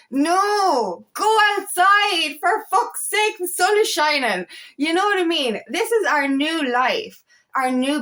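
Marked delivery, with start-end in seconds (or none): 0.1-4.5 raised, high-pitched protest; exasperated retort. Acted. Talking back to the fearful ego mind, exasperated. Answers back the ego's warning.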